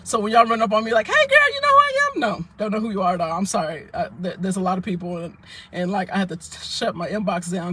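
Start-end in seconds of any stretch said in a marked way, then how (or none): 1.1-2.2 much higher, squeaky mock greeting; mock stranger. Mocking fans who run up to her, in a squeaky high voice. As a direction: mimic an overfamiliar stranger, high and bright.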